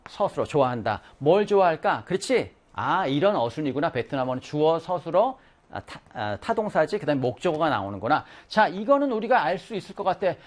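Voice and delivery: ordinary talk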